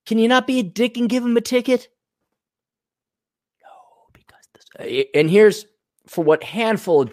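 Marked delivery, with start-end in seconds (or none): 0.0-1.9 pleading caller voice. Acted.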